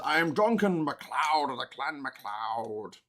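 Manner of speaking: slow mock Scottish accent; drawn-out, hammed-up Scottish proclamation; Highlander. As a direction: declaim it in a bad Scottish accent. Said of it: "Christopher Lambert in Highlander, his Scottish accent".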